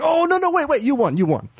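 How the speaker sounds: high, hasty mock backpedalling; flustered game. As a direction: blurt it as a game correcting itself. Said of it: "and it's like".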